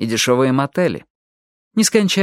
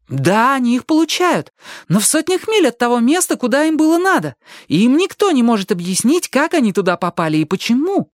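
very high, heated objection; indignant; 11 semitones higher than usual. A narrator's reading. Ali objects that people end up miles away, indignant. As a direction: argue back with outrage, pitched high.

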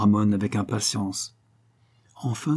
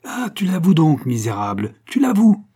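high, loud cries of accusation; furious; 7 semitones higher, 6 dB louder than usual. A narrator's reading. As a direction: pounce on the confession in fury. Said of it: "ivre de fureur". (ivre de fureur)

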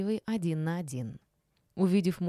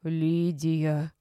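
indignant; 3 dB louder than usual. A narrator's reading. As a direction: scold her by name. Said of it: "возмутилась Рима Марковна". (возмущённо, низким голосом)

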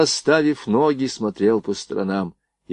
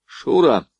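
raised-pitch hail; short, higher call; 5 semitones higher than usual. A narrator's reading. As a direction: call the girl over by name. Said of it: "окликнул Борейко".

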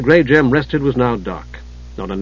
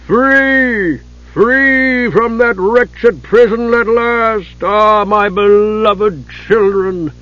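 high, loud, slow triumphant giant; giant's voice; 8 semitones higher, 4 dB louder, much slower than usual. A narrator's reading. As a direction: gloat like a freed giant.

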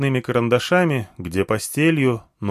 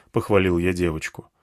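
ordinary delivery

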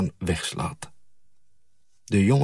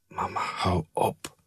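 soft pleading whisper; 5 dB quieter than usual. A narrator's reading. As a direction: beg her quietly to stop. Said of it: "fluister ik".

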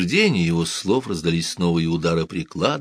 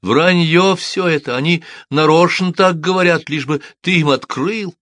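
higher, louder insisting wife; insistent wife; 10 semitones higher, 5 dB louder than usual. A narrator's reading. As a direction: she won't let her husband open. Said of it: "не сдавалась женщина".

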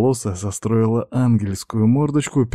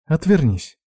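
higher, mildly flustered request; poorly hidden embarrassment; 7 semitones higher than usual. A narrator's reading. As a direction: ask it high, hiding your embarrassment. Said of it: "проговорила Юна с плохо скрываемым смущением".

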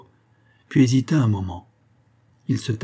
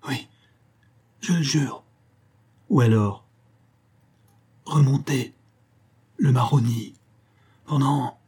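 broken phrases with long gaps; failing voice; 4 semitones higher than usual. A narrator's reading. Tortured boy swearing to guide the bandits, voice failing. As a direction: force out each word through pain. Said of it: "La voix devint inintelligible".

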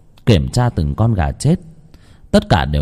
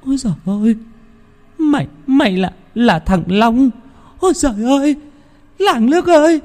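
high shrieking, panicked wail; terrified scream. A narrator's reading. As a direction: scream in terror at a ghost. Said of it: "hét lên thật to". (hét lên kinh hãi, giọng cao the thé)

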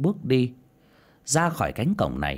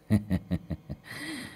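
smug laugh; 5 dB quieter than usual. Acted. Huân gloats over deceived Thi.

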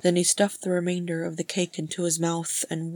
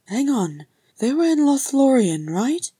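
higher, loud dawning realisation; eager deduction; 8 semitones higher, 7 dB louder than usual. Acted. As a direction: work it out aloud, eagerly. Frerin works it out aloud.

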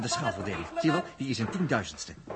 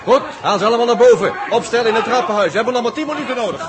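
louder, higher commands to the men; urgent shouted orders; 7 semitones higher, 9 dB louder than usual. Acted. Jaap commanding the elders during the siege, shouted urgently. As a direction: bark the orders up the stairs.

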